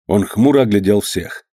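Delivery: plain reading voice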